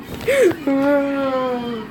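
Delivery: high airy yawn. Shown as a demonstration. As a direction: let out a big yawn. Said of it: "That's how I yawn".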